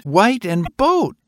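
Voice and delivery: put-on high child voice, slowing on boat; a boy trailing off at his boat, slow; slow, high child's voice; excited little boy. A narrator's reading.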